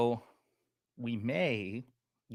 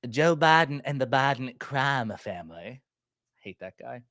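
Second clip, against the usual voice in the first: James Comer impression; 3 semitones higher, 6 dB louder than usual. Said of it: "I love the impersonations".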